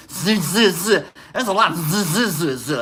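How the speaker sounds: repeated buzzing z sound; buzzing z drill. Shown as a demonstration. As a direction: drill the z, z, z like speech therapy. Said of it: "I did speech therapy as a child".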